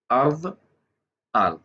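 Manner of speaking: अर्द pronounced on its own, Arabic-style; Arabic pronunciation. Shown as a demonstration. Teacher showing learners how to read अर्द, careful Arabic pronunciation. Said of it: "अरबी की pronunciation".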